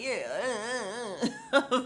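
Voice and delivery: mocking thick-necked guy voice; exaggerated meathead grunting impression; thick-necked man. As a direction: grunt it out as if the neck is too thick. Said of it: "he talk like his neck is thick".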